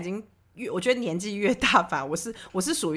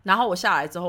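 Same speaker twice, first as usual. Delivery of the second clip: ordinary delivery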